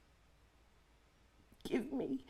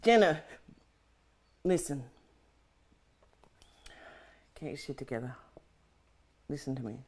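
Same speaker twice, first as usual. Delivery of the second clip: lower, softer reassuring voice; calm self-talk; 10 semitones lower, 6 dB quieter, faster than usual. Acted. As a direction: talk yourself down like a friend. Talks herself down gently.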